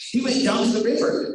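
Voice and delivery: usual speaking voice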